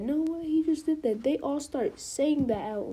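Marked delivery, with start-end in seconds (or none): 0.0-1.2 higher, still disbelieving; stunned repeat. Retold or imitated. Examinees echoing each other, higher, still stunned. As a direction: say it again, higher, still in shock. Crowd shocked at exam site.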